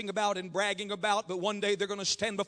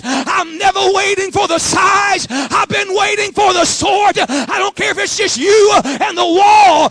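loud, high, driving exhortation; full-force preaching; 7 semitones higher, 19 dB louder than usual. Acted. Preacher exhorts workers to give everything, full-force. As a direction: build to a long shouted finish.

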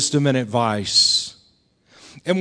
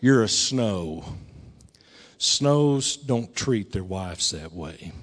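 slow, low and soft fatherly reproof; stern dad; 9 semitones lower, 8 dB quieter, slower than usual. Retold or imitated. Dad reproves married son about his wife, quietly stern. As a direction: weigh each word, quietly grave. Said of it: "and he said".

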